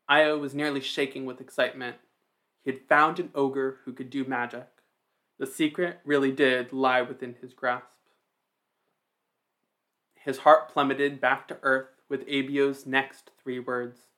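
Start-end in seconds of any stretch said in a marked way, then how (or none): none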